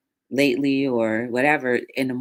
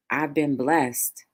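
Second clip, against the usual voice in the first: normal talking voice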